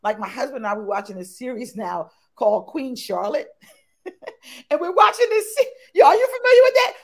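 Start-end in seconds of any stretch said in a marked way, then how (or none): 4.7-6.9 high, loud, laughing gush; excited gushing. Real, not acted. Host gushing about Bridgerton to Melissa, excited and loud. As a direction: gush about the show like a fan.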